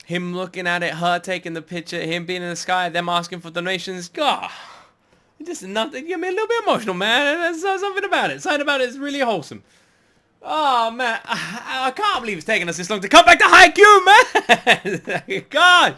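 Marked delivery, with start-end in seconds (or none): 4.1-9.6 emotional. Real, not acted. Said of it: "I'm getting emotional over this". 10.4-15.9 high and loud through laughter; a loud, happy laugh at finally being back. Real, not acted.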